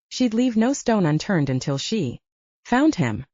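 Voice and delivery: usual speaking voice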